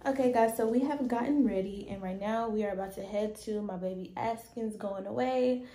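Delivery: ordinary talk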